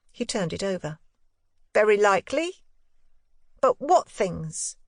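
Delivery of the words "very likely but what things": put-on a boy fencing carefully; boy Miles. A narrator's reading. Boy voiced by reader.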